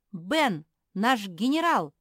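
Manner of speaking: ringing, admiring exclamation; exclaiming. A narrator's reading. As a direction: proclaim him proudly. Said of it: "воскликнула Мафи".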